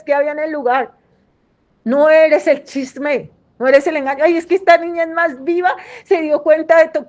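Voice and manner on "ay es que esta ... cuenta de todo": higher, quick, louder mock brag; mocking proud parent. Mocking a parent bragging about her clever girl, higher and quick. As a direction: gush like a gossipy mother.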